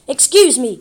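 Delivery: loud, offended excuse me; indignant. A narrator's reading. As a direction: bark "Excuse me!" loudly, falling hard on excuse. Confused question then outraged repeat.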